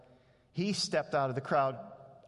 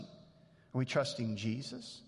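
normal talking voice

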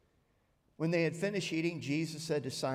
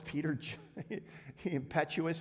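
ordinary talk

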